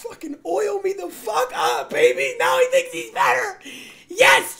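high squeaky laughing voice; laughing squeal. Pretend.

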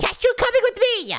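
very high-pitched yelp; exasperated yell. Acted. Boy gives in to friend tagging along, exasperated high yell. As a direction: squeal it out in exasperation.

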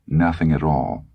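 ordinary delivery, nothing marked

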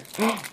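high gasp of surprise; quick thrilled intake of breath; delighted gasp. Real, not acted. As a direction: gasp on spotting a treasure. Spots a butterfly piece.

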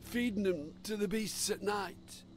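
put-on old fisherman voice. A narrator's reading. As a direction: tell it like a haunted old sailor.

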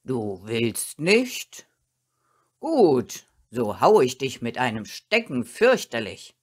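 low, loud threatening master; stern dog master. A narrator's reading.

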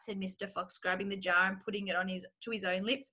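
ordinary delivery, nothing marked

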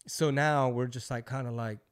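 ordinary talk

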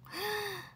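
a quick, high surprised gasp; sharp gasp. Real, not acted. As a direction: gasp at the news. Reacting to viewer waking early. (驚いて息をのむ)

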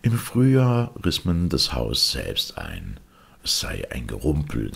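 plain reading voice

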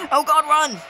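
loud, high panicked yell; panicked shout. Real, not acted. Chased by monster.

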